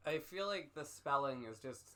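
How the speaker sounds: ordinary delivery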